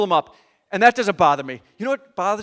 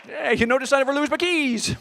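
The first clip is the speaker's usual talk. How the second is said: higher, sing-song gloating; smug taunting; 7 semitones higher than usual. Retold or imitated. Gloating to his wife about the key hook, smug. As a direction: needle her like a know-it-all.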